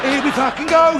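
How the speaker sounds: can't believe his luck; eager gloating. Retold or imitated. Excited thought, seeing her naked.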